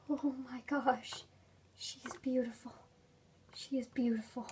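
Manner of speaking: breathless whisper; hushed, amazed whisper; awed whisper. Real, not acted. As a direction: whisper in awe at the toy. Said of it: "I'm still speechless".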